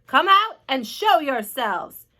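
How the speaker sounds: loud, slow official order; police chief hailing from below; calling out. A narrator's reading. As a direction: call up a slow command. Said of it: "called the chief of police".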